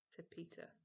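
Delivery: ordinary delivery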